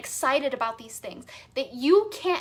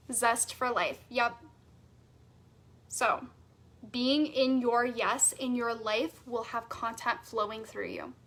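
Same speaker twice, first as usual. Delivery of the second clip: ordinary delivery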